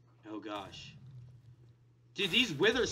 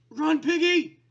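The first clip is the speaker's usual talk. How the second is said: high, loud warning call; urgent shout; 5 semitones higher, 7 dB louder, slower than usual. Pretend. Player warning piggy of the wither, urgent shout. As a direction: call out in mock alarm. Calling to hunted pig.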